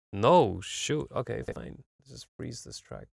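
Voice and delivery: ordinary talk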